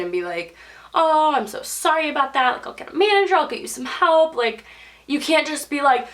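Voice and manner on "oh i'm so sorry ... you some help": higher-pitched customer-service politeness; polite apology. Shown as a demonstration. Restaurant worker placating a rude customer, politely apologetic. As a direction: apologise sweetly, eager to help. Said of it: "you've gotta be, like, the bigger person".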